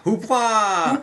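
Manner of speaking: high, loud mock shout. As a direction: belt the word out like a cheer. Mock outrage shout of hoopla.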